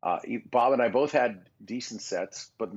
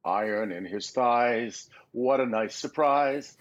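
Newton from Hercules; 4 semitones higher, slower than usual. Retold or imitated. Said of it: "Newton from the Hercules cartoon".

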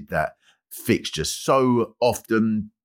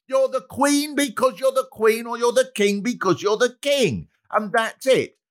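high, pitched-up, rising indignation; indignant monarchist rant; 11 semitones higher than usual. Acted. Comedian ranting at republicans in a high, indignant voice. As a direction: rant like an outraged royalist.

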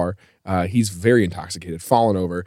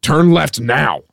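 slow, higher mock command; yelled order; 4 semitones higher, 3 dB louder, much slower than usual. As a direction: re-enact the demanding passenger, drawn out. Repeats passenger's yelled order on request.

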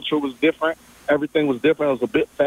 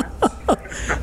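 short breathy laugh; 10 semitones higher, 3 dB quieter than usual. Real, not acted. Guest laughs along with host.

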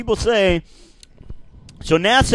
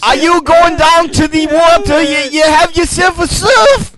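higher, louder put-on Aussie voice; mock Australian; 9 semitones higher, 5 dB louder than usual. Friend answers the surfer in a bad Australian accent. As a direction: play a cartoon Aussie bloke. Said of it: "There's a Brennan voice".

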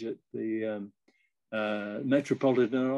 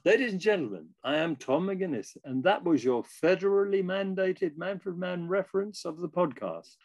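higher-pitched formal announcer delivery; podcast announcement; 7 semitones higher than usual. Shown as a demonstration. Guest musician performing the hosts' catchphrase on request, formal announcer. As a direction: announce it to an audience like a show host. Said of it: "say the phrase that we usually say every episode".

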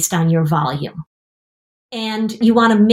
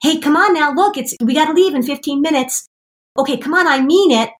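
higher, lightly sing-song coaxing parent; nice wake-up nagging; 7 semitones higher than usual. Retold or imitated. Parent nicely nagging a kid in bed, gently coaxing. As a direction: coax a sleepy kid out of bed, a touch higher. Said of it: "you come in nicely and say".

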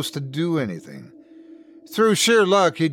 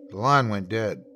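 ordinary delivery

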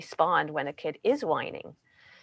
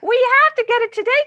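high, louder, fast pleading whine; whining; 8 dB louder, faster than usual. Shown as a demonstration. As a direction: rush the demand in a high whine. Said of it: "Our voice goes up. We start to whine".

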